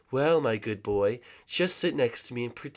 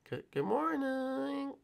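high singsong; 10 dB quieter, slower than usual. Acted. Waking Gunther gently, falsetto.

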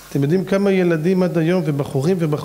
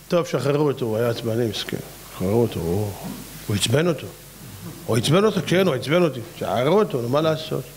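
low, soft, shrugging mock court voices; mock lenient judges; 7 semitones lower, 3 dB quieter than usual. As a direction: play the judges waving it off lightly. Mock court freeing the killer. (שופטים מדומים בקול נמוך ומזלזל)

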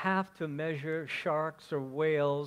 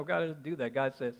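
normal talking voice

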